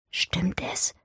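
soft, hushed question; whispered. A narrator's reading. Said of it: "flüsterte Ermengarde". (geflüstert)

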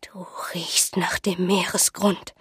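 put-on slow, soft, whispery old seer; uncanny old woman, hushed; eerie pronouncement. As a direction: half-whisper it slowly and ominously.

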